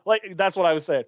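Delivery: ordinary talk, nothing marked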